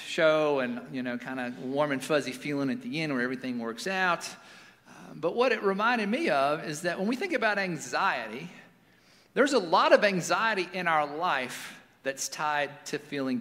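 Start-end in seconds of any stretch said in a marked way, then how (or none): none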